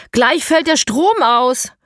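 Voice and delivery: high, loud, slow warning; urgent shout. A narrator's reading. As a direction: call it out as an alarm. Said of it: "ruft Elias". (laute, langsame Warnung)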